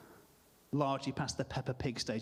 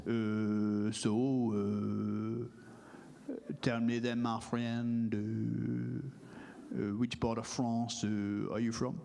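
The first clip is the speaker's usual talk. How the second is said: put-on low, drawn-out French accent with long hesitations; French-accented football manager, slow and monotone; thick French accent, dragging out each "uh"; Arsene Wenger; 6 semitones lower, much slower than usual. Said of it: "that's my French accent".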